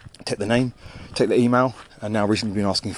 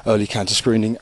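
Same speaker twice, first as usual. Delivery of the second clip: ordinary delivery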